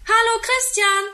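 high, loud call from a window; boy's eager high call. A narrator's reading. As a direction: shout a greeting down to a friend. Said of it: "ruft er aus dem Fenster".

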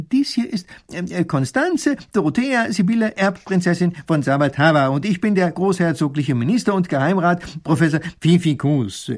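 höher, stockend mit vielen Ähs; zerstreuter Professor. Acted. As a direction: ein verwirrter alter Gelehrter sucht die Worte. Professor stellt vor. (zerstreuter Professor, höher und stockend)

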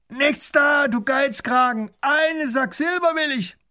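high, loud, slow mocking robber, laughing between words; booming robber voice. Robber taunts the princess across the river, loud and mocking. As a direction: crow over the rich visitors with a big jeering laugh.

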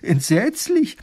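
high, loud, thrilled telling with sound effects; husband thrilling his wife with the tale, high and loud; excited retelling. Acted. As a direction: act out the fight breathlessly. Husband acts out the dragon fight.

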